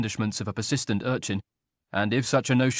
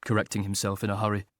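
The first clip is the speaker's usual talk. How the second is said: ordinary delivery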